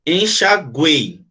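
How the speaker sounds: "Enxaguei" drawn out slowly, en-xa-guei; slow "Enxaguei" down so the class can split it; "Enxaguei" stretched, with "guei" kept together; slow syllable demo. Shown as a demonstration. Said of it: "como é que separa enxaguei".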